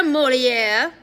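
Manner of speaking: Molière loud and high with French flourish; exaggerated French accent. A narrator's reading. Addison introducing his sister Molière to the guard, French accent. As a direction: present Molière grandly in a French accent, rising. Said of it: "speaking in an exaggerated French accent".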